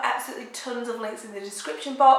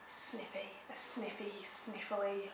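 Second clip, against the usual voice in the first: very soft, mumbling to herself while hunting for the word; muttered word search; 20 dB quieter, slower than usual. Real, not acted. Vlogger with a cold, hunting for the right word, mumbling. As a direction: trail off into a near-mumble, trying words out.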